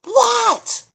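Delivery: high shrill what; a sudden high-pitched cry of disbelief; shocked shriek. Pretend. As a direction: yelp it in mock shock. Mock outrage at own topic.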